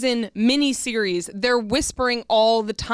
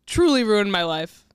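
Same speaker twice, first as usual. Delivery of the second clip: ordinary talk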